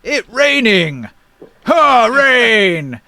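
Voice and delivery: high, loud, slow giant; simple giant exclaiming with drawn-out words. Acted. Giant hit by falling coins, high slow giant voice. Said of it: "this giant has some coins hit him in the head".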